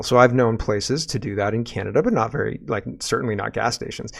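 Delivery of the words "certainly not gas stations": ordinary talk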